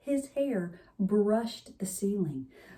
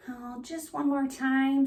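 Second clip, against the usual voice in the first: put-on pleading kid; 6 semitones higher than usual. A narrator's reading. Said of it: "said Walter".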